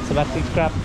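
normal talking voice

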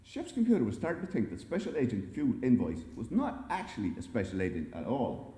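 ordinary delivery

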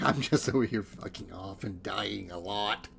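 normal talking voice

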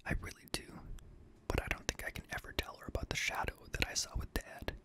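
ordinary delivery

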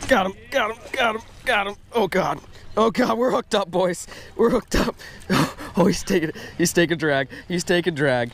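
high, breathless hooked-up excitement; fighting a fish. Real, not acted. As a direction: call it out mid-fight. Fish on the line.

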